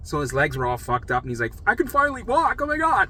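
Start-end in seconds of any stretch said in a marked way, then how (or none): 1.7-3.1 high-pitched, excited retold outburst; overjoyed patient. Roommate celebrating being able to walk, overjoyed. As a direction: marvel in a much higher voice.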